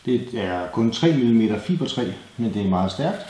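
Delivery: ordinary talk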